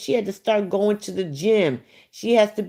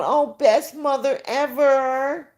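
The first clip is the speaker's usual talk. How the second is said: higher, sarcastic gushing; mocking fan voice; 6 semitones higher than usual. Mocking fans who call her the best mother, sarcastic. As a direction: mimic the fans' praise with contempt.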